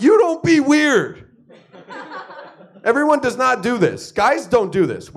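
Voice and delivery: high, loud, slow mock outrage; loud laughing retort. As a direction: throw it back at her, laughing and outraged. Comic retort to wife.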